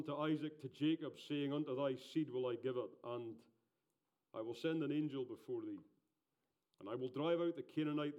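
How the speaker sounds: loud, solemn scripture reading; booming biblical narration. Acted. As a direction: proclaim it from the pulpit. Reads scripture aloud.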